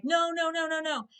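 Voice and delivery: high, pleading retold wail; mock crying. Retelling Hiccup and Astrid at his father's death, mock crying. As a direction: imitate distraught cries of denial. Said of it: "And they're crying".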